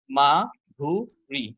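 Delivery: মাধুরী very slowly in three beats, মা, ধু, রী; split into syllables. Shown as a demonstration. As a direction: pause after each syllable of মাধুরী. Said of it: "কয়টা আছে".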